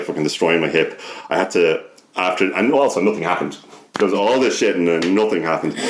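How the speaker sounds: ordinary talk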